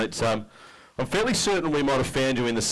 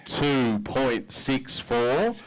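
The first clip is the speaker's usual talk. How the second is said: very slow, stretched number reveal; drawn-out reveal; 3 semitones higher, much slower than usual. Real, not acted. Emcee reading the angler's weight, drawn out slowly. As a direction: hold each word to build suspense. Weigh-in result announced to crowd.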